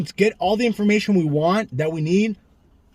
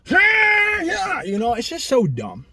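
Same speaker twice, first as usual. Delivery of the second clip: outburst; 12 semitones higher, much slower than usual. Real, not acted. Outraged rant about the stabbing.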